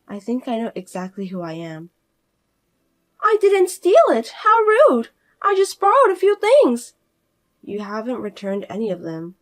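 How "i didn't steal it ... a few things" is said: high, loud, self-important outrage; indignant sister-in-law. Acted. Fiona demanding her sister-in-law respect her, high and indignant. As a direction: demand respect she hasn't earned.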